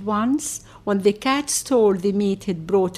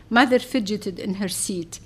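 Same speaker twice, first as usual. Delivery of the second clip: plain reading voice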